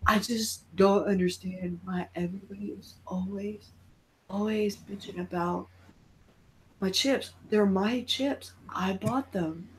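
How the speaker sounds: a hint of a sulky office Pinhead moaning about chips; whiny Pinhead. Pinhead whining to coworkers in a cubicle, soft and low. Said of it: "Pinhead in a fucking cubicle going".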